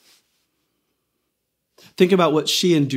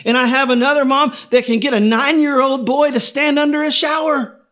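much higher, louder, insistent; God refusing to rest, so close to finishing; a hint of an excited creator; eager God; 8 semitones higher, 4 dB louder than usual. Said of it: "And God said".

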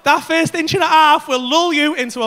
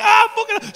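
mock pained yelp; 9 semitones higher, slower than usual. Retold cry of pain.

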